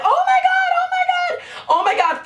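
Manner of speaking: screeching, very high, laughing yells; freaking out. Retold or imitated. Streamer shrieking as viewers jump to twelve thousand, ecstatic. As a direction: shriek it in disbelief as viewers flood in. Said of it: "freaking out".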